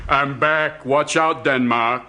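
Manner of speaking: put-on lightly done Austrian Schwarzenegger accent. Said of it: "Schwarzenegger walks into the room".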